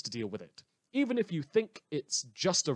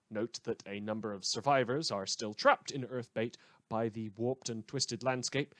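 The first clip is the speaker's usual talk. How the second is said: usual speaking voice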